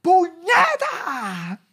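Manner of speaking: high, loud, slow furious yells; mock furious wife yelling; wife's screaming. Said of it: "empieza a gritar".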